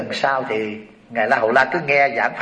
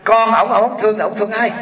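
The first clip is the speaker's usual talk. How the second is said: mock indignation; 7 semitones higher than usual. Rich lady scolding the Buddha for neglecting his son, indignant. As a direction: huff like an offended rich lady.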